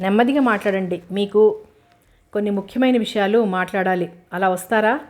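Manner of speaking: usual speaking voice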